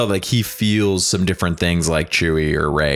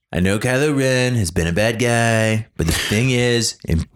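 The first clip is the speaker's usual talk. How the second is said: raised, breezy politician voice; mock politician speech; 4 semitones higher than usual. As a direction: announce the pardon like a careless president. Mock speech pardoning Kylo.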